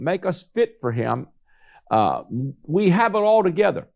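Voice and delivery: normal talking voice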